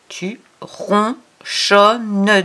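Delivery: syllable by syllable, very slow; very slow reading. Shown as a demonstration. Teacher to child, reading "ronchonnes" very slowly. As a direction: spell it out in sound, "tu ron-chon-nes". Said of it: "slow model reading".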